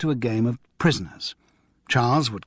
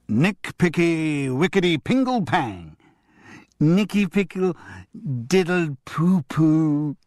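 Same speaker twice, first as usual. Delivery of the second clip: put-on high, slow, daring little boy; a naughty kid savouring rude nonsense; cheeky child voice; 5 semitones higher, slower than usual. Damien daring to say silly rude words, high and slow. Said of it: "Damien snickered at his daring".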